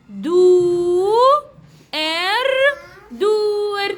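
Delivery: "دو", "إر" held long, then blended into "دور"; syllable sounding-out. Shown as a demonstration. Teacher writing a word for the class, sounding out "دو إر دور" in drawn-out syllables. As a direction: stretch "دو" and "إر" apart, then join them as "دور".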